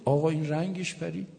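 normal talking voice

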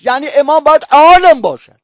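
«عالم» stretched out and loud; stress on عالم. Real, not acted. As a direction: swell hard on «عالم», then fall. Preacher drives home the key word.

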